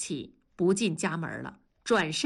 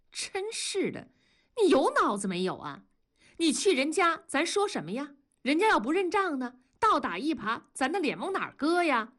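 higher, scolding and exasperated; reproachful; 6 semitones higher than usual. A narrator's reading. Wenli scolds her husband for wanting to go to the police, high exasperated reproach. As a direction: pitch jumps up as she scolds. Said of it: "文丽责怪地说".

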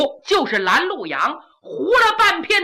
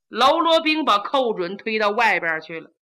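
plain reading voice

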